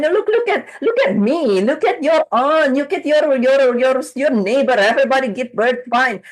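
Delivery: put-on high, bright-pitched soothing of a worried child; calm her fears like a mother; reassuring mom. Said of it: "what does parent do?".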